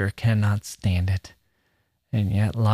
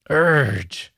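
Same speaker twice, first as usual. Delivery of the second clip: higher, louder drawn-out urge like a groan; growled urge; 4 semitones higher, 4 dB louder than usual. Shown as a demonstration. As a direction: let the word urge become a groan. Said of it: "Is that an onomatopoeia".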